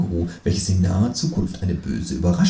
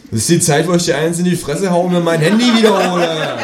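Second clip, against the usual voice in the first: laughing threat; 8 semitones higher, 5 dB louder than usual. Acted. Threatening kangaroo.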